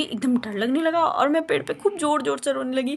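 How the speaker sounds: ordinary talk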